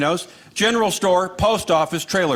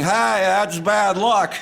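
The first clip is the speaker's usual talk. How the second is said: put-on slow, slurred drunk guy; lightly voiced drunk; much slower than usual.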